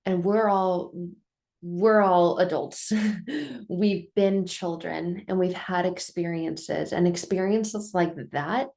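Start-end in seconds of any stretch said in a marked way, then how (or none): 3.0-3.6 short, light laugh; a brief laugh to herself; small chuckle between sentences. Real, not acted. Laughs at her own remark.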